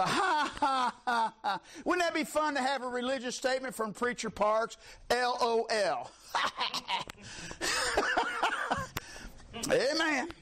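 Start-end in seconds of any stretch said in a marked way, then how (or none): 0.0-1.6 deliberate, spelt-out scornful laugh; mock laughter in separate beats. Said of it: "He's mocking them". 6.3-9.3 high put-on cackle; high staccato hee-haw of derision; mocking laugh. Acting out a laughing religious statement.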